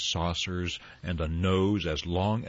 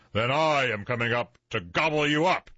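raised, high storybook yell; troll yell; 6 semitones higher, 4 dB louder than usual. A narrator's reading. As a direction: threaten loudly as the angry troll. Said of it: "yelled the troll".

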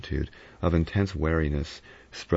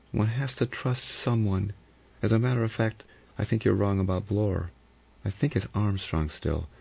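higher-pitched woman character, measured; Vera, uneasy but composed; 4 semitones higher than usual. Acted. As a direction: voice Vera a little higher, reasoning it out.

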